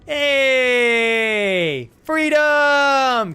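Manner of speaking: high, loud triumphant yell; joyful shout. Real, not acted. Streamer cheering escape in the game, loud yell. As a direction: shout it out like you just broke free.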